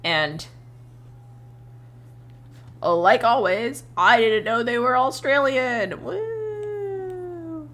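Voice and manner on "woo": high excited whoop; woo. Real, not acted. To chat about Australian streamers, excited whoop. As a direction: let out a sudden high cheer.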